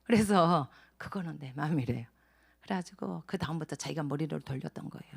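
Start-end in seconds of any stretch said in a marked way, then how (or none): 2.6-5.1 whispered aside. Real, not acted. Aside in testimony.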